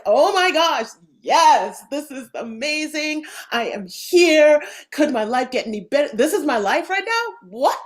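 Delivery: bursting with joy at life; thrilled. Retold or imitated. Describing the body's open yes, excited. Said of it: "Do we feel like".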